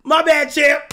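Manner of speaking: high, loud laughing through words; laughing loudly at a friend's joke. Real, not acted. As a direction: crack up while talking.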